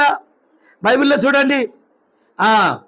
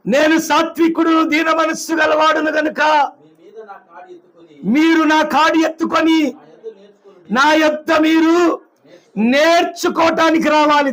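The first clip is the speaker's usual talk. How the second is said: preaching at full force; 4 semitones higher than usual. Acted. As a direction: thunder the verse out to the hall. (బిగ్గరగా నెమ్మదిగా ప్రకటించే వాక్యం)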